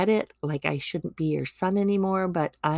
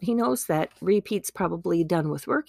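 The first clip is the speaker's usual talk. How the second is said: ordinary talk, nothing marked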